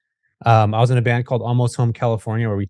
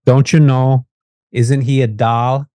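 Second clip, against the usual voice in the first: imitated Fargo accent with drawn-out vowels; North Dakota accent; 4 dB louder, slower than usual. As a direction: put on a Fargo, North Dakota lilt, louder at the start. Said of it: "this woman that had this beautiful accent".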